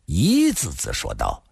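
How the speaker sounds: usual speaking voice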